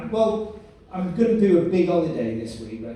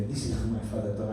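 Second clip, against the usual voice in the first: put-on low Spanish-accented voice; father's Spanish accent; 7 semitones lower, 7 dB quieter than usual. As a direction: imitate a Spanish dad, low and soft. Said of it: "he has a very sexy Spanish voice".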